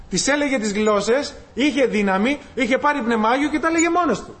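ordinary talk

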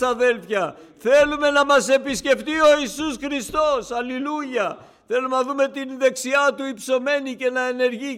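loud, high, fervent sermon voice; high, loud pulpit cry; full-force preaching. Acted. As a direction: preach it out to the whole church, ringing.

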